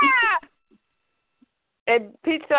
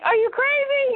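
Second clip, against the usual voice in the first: incredulous Pete. Acted. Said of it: "Pete's looking like".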